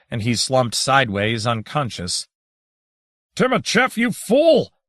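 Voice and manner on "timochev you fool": much higher, louder bawl upward. A narrator's reading. Vincent Rue yelling up at Timochev, bawling. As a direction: bawl at the ceiling. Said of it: "Vincent Rue bawled".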